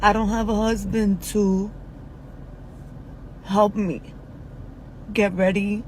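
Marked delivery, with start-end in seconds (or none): none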